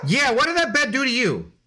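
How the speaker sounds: mock-scold the dog for attacking the bed; teasing the dog.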